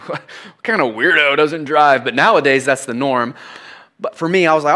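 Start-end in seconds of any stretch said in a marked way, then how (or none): 0.1-2.0 mock incredulous. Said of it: "I'm like".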